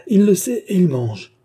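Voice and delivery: high, loud indignant outcry; outraged howl. A narrator's reading. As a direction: cry out in utter exasperation. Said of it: "hurla la comtesse".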